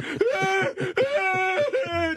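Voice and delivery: high hammed-up whimpering; mock frightened crying. Scared kid cowering while cleaning, mock whimpers. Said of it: "just crying and rubbing wood".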